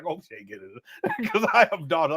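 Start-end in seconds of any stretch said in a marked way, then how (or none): 0.0-0.8 much lower and softer, a private worried hope; worried father murmur. Retold or imitated. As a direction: murmur it to yourself like an anxious dad. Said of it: "I be like".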